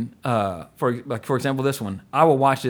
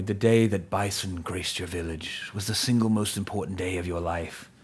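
menacing villain line; 6 semitones lower, 7 dB quieter than usual. Retold or imitated. Podcaster quoting Bison's speech to Chun-Li, low and soft. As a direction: say it like a bored dictator. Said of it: "the way he plays it".

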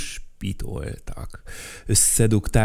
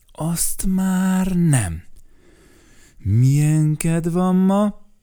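higher, slow and drawn out; high character voice; 8 semitones higher, 4 dB louder, much slower than usual. Acted. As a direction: stretch the words out high. Lively dialogue, slow high. (magasabb, lassú, elnyújtott hang)

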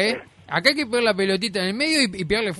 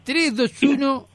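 slow, announcer-style countdown; 5 semitones higher, slower than usual. Acted. Host counting down to the last joke, slow and announcing. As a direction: count it down slowly for the show. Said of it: "countdown to the last joke".

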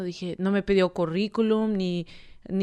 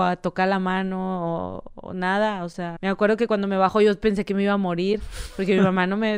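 usual speaking voice

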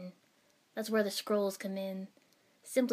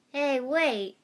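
put-on higher, louder boyish protest; loud, raised-pitch exclamation; exclaiming; 5 semitones higher, 7 dB louder than usual. A narrator's reading. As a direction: call out to stop them, indignant. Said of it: "Naruto exclaimed".

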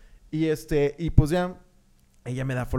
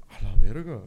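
shocked curse; 4 semitones lower, 10 dB louder than usual. Retold or imitated. Retold reaction to accusing photo.

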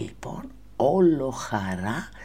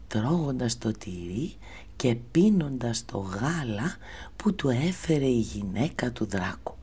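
plain reading voice

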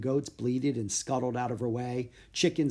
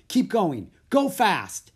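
louder and higher with each urging; rising to a shout; 7 semitones higher, 7 dB louder, slower than usual. A narrator's reading. Boy urges elephant onward, voice rising to shout. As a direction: build from firm urging into a shout. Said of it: "His voice rose to a shout".

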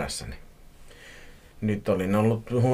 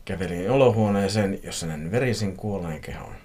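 ordinary delivery, nothing marked

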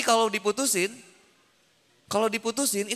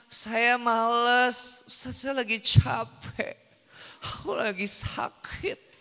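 slow, drawn-out mock self-pity; whiny complaining; 3 semitones higher, much slower than usual. Ustaz mimicking a student's excuses, slow whiny self-pity. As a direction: drag each excuse out like a tired student. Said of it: "Kita tuh selalu membesarkan rasa".

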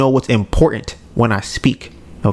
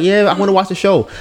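lightly raised pitch, quick delivery; quick, higher-pitched retold yes; retold agreeable reply; 7 semitones higher, faster than usual. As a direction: quote yourself readily agreeing. Quoting own reply to girlfriend.

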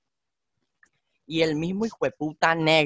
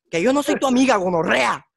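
mock angry snap; 7 semitones higher, 6 dB louder than usual. Policewoman snapping at him.

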